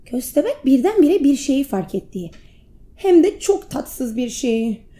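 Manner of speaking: ordinary delivery, nothing marked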